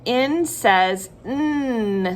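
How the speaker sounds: slow "en says nnnn" for N says N; name N, then hum a long N; letter then sound. Shown as a demonstration. Teacher modelling for children, slow N says N with hummed sound. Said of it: "Say it with me".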